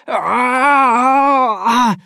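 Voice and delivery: long, loud high scream; drawn-out "aah" of unbearable pain. A narrator's reading. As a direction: scream out in agony. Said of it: "sprang omkring i lägenheten och skrek".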